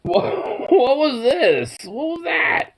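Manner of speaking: very high, slow squeal through laughter; laughing surprise. Real, not acted. Owner startled by her dog, high laughing squeal. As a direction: shriek of amused disbelief.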